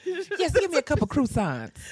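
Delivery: amused mock grandma; imitated grandma. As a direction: mimic grandma placing her order. Imitating grandma ordering croissants.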